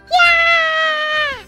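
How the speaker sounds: high, loud drawn-out "yeah" scream. Acted. Girl screaming at a rat, high drawn-out shriek. Scream inside the game's story.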